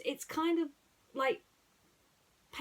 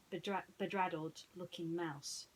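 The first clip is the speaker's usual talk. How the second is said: ordinary delivery, nothing marked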